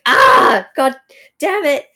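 loud, anguished outburst; frustrated shout. Retold or imitated. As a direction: yell it like you're cursing yourself. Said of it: "now he's just going".

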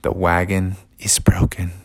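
hushed whisper of bad news. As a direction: whisper it gently. Friends break bad news.

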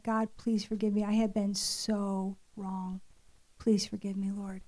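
low, soft and humbled; hushed repentant prayer. Retold or imitated. Mother repenting before her children at table, hushed and contrite. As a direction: confess quietly, sinking to a murmur at the end.

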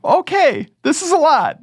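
high-pitched reaction to being swamped; mock overwhelmed. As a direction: act taken aback, pitch jumping way up. Acting out reaction to the list.

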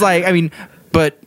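ordinary talk, nothing marked

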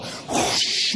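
airy voiceless whoosh; rushing-air sound effect; imitated whoosh. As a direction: make the sound of a ball shooting away. Imitates ball shooting from water.

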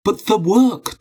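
high-pitched insistent protest. A narrator's reading. Husband defending his work to his wife, insistent. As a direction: object urgently, pitch jumping up. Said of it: "Dmitri insisted".

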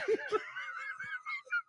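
squealing high laugh. Real, not acted. Laughing after the quote.

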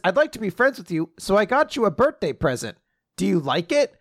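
high, fast sweet-creepy villain; lightly voiced eager villain offering a gift; villain voicing. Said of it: "Ruin says".

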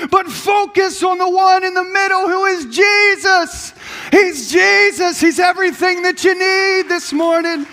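high, loud, shouted sermon climb; full-force preaching. Acted. Pastor rousing the congregation about Jesus, shouting with fervour. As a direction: preach it to the back row.

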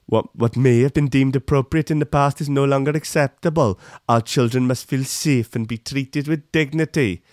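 sing-song put-on Welsh accent; mock Welsh accent. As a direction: recite it as a caricatured Welsh minister, Welsh accent. Repeats minister's quote as Welsh impression.

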